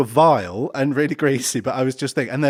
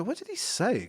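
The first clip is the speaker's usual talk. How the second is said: high mock confusion; 9 semitones higher, 7 dB quieter than usual. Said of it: "And they go".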